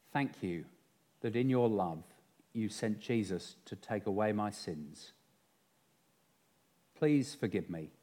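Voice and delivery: quiet, measured prayer with long pauses; soft, unhurried praying voice, phrase by phrase. Real, not acted. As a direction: lead a congregation gently through a confession. Leads a prayer.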